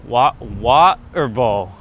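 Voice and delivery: slow British water bowl, restarted; water bowl with the T dropped, British style. Shown as a demonstration. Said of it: "I say".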